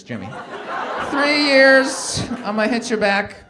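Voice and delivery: butter up Jimmy then ask for cash; to vaccinated friend Jimmy, loud pleading sweet talk; sweet-talking borrower. Retold or imitated. Begging vaccinated friend for money.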